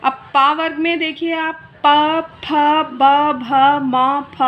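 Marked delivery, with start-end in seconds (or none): 1.9-4.4 प फ ब भ म said one by one, a little louder, each clearly from the lips; lip sound demo. Shown as a demonstration. Teacher to students showing lip sounds, crisp demo. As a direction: pop out प, फ, ब, भ, म separately with the lips. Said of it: "आप खुद बोलकर देखिए कि पा को बोलते".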